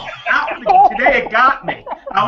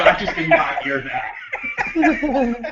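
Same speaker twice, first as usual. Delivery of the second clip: laughing disbelief; 5 semitones higher than usual. Retold or imitated. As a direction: laugh through the denial. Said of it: "I go".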